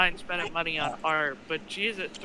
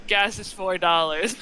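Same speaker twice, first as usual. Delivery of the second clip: ordinary talk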